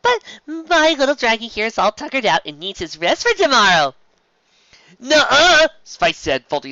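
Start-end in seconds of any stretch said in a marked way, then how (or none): 0.0-3.9 higher, louder forced cheer; falsely cheery. A narrator's reading. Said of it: "in a falsely cheery voice". 5.0-5.7 high, loud stubborn refusal; young dragon refusing bedtime; kid dragon protest. A narrator's reading. Spike protesting.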